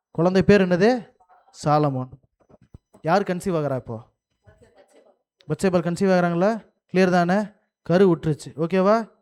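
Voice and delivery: ordinary talk, nothing marked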